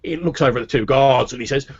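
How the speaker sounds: normal talking voice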